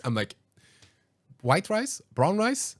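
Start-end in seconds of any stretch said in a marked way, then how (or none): 1.4-2.8 waiter voice. Retold or imitated.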